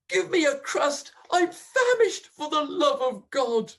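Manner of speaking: high, loud desperate begging; starving wife crying out for food; groaned plea. Acted. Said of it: "she groaned".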